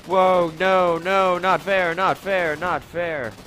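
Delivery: high, loud whiny protest. Real, not acted. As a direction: wail it's unfair at the game. Losing in game, protesting.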